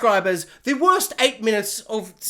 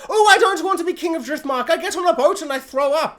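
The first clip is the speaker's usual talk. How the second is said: put-on little Strong boy whining about Driftmark; whiny kid; 8 semitones higher than usual. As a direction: whine like a seasick child. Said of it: "he's all like".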